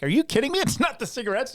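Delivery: high, disbelieving retort; mock the host's disbelief; incredulous. Radio host to guitarist, high incredulous retort. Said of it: "and Howard's like".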